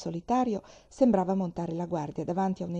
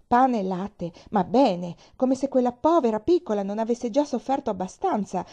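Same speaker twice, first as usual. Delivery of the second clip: louder, indignant grumble to herself; furious; 4 semitones higher, 7 dB louder than usual. A narrator's reading. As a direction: fume over the unfair punishment. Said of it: "diceva tra sé furiosa".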